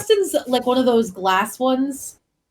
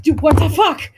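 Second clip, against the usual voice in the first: acted shock, jumping higher and louder; startled outburst; 6 semitones higher, 5 dB louder than usual. Friend acting out being hit by sudden hot water, startled outburst. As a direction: blurt it out in mock alarm.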